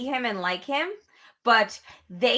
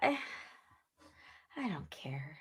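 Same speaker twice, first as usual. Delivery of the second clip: whispering; 7 semitones lower, 18 dB quieter than usual. Real, not acted. Whispered reply to teasing.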